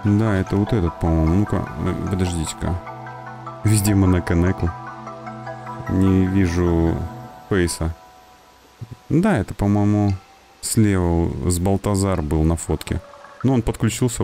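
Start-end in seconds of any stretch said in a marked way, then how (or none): none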